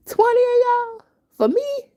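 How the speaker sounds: very high, loud squeal of outraged disbelief. Retold or imitated. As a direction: shriek it like you can't believe the nerve. Mock outrage at twenty attackers.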